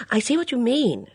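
sudden realisation. A narrator's reading. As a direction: blurt it out as it dawns on you. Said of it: "said Bunch suddenly".

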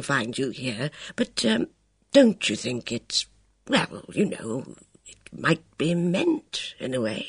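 put-on a kindly old man struggling to say it; hesitant elderly officer, earnest; hesitant old colonel. Luscombe hesitant appeal.

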